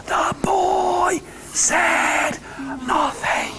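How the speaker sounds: slow whispered line; hushed whisper. Shown as a demonstration. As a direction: whisper it slowly for the kids. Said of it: "Can you do that with me?".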